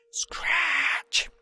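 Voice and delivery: high unvoiced scratch; whispered scratch. A narrator's reading. Narrator voicing the last noise, whispered scratch. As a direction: hiss a single scratch. Sound effect in story.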